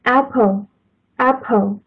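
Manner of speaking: apple，apple said slowly with a clear aspirated p; careful slow demo. Shown as a demonstration. Teacher slowly modelling apple for students. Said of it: "最简单的".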